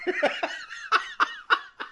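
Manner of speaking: high, squeaky laughing fit; helpless laughter. Real, not acted. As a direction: break up laughing after the flubbed slogan. Cracks up after botched slogan.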